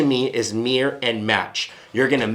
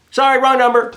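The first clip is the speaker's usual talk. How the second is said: dismissive brush-off; 9 semitones higher, 5 dB louder than usual. Retold or imitated. Acting a person hanging up.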